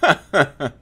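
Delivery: high little burst of laughter; short scoffing laugh. Real, not acted. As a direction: laugh it off scornfully. Laughs after scorning the writer.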